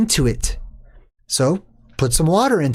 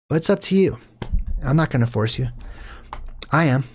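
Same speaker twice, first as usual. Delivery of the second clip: usual speaking voice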